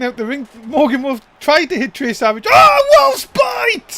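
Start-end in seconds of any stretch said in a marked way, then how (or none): 2.5-4.0 stretched, much higher and louder call of the move; excited shout. Real, not acted. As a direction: yell the finisher's name like a big ring announcement. Calling the finishing move.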